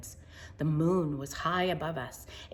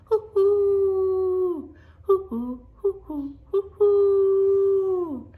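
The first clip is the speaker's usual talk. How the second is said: long, high, loud hooting owl; an owl answering from the dark woods, loud hoots; 14 semitones higher, 8 dB louder, much slower than usual. Acted. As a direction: let each hoot ring out through the trees.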